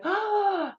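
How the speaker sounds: a high, drawn-out "Oh" of awe; impressed gasp. Retold or imitated. Crowd at Peter Quill's move, impressed high oh. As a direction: everyone admires the stunt. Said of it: "everybody's like".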